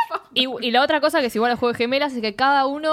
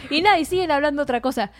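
usual speaking voice

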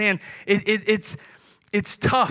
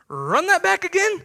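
higher, incredulous ask; mock disbelief; 7 semitones higher than usual. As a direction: pastor voicing an outsider asking again in disbelief. Mock non-believer asks again.

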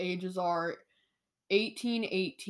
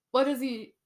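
ordinary talk, nothing marked

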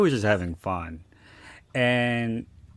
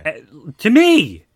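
loud, high, drawn-out protest; slow, emphatic and much louder; exasperated exclamation; 8 semitones higher, 12 dB louder, slower than usual. Real, not acted. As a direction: burst out in disbelief, pitch leaping up. Indignant he said it to her.

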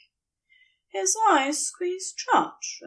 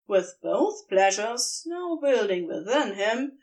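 ordinary delivery

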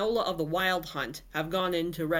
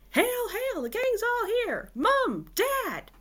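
much higher, elated calling out; joyful shout; 3 dB louder, slower than usual. Acted. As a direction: call down to them with joy. Joyful reunion cry from the door.

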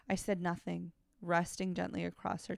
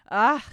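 loud high gasp; a sudden loud cry of pleasure; sharp gasp; 5 semitones higher, 15 dB louder than usual. Acted. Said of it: "she gasped again and again".

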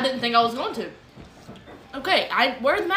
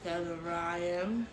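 quiet; 5 semitones lower, 12 dB quieter, much slower than usual. Real, not acted. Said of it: "If you couldn't hear that".

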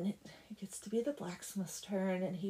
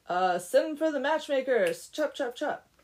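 loud, higher-pitched order; brisk command; 4 semitones higher, 7 dB louder than usual. Acted. Doodad sending for matchmakers, brisk loud command. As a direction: call out orders to hurry everyone along.

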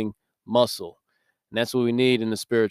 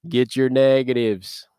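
slow, louder Southern drawl of a gym coach; Southern coach accent; 2 semitones higher, 5 dB louder, slower than usual. Retold or imitated. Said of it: "He'd go around the gym".

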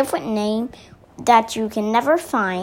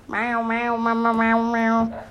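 string of playful cat meows. Acted. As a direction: meow like a cartoon cat. Cat character meowing in story.

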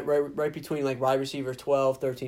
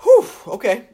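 excited; 8 semitones higher, 11 dB louder than usual. Real, not acted. Big exhale, coming down from high.

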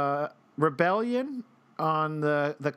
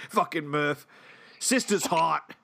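put-on Boston accent. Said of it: "our best Boston accented".

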